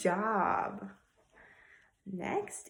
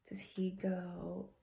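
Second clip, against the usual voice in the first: whispering; 9 dB quieter than usual. Acted. Whispered lead-in to monkey.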